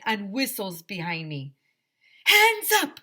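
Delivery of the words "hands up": high, loud megaphone yell; police bullhorn order, way up high and loud; bullhorn shout. A narrator's reading. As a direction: bark it through a bullhorn. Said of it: "a voice shouted through a bullhorn".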